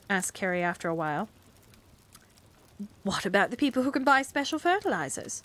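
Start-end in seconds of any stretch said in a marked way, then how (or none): 3.0-5.4 heated, higher retort. Acted. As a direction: snap back at her. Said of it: "retorted Miss Price".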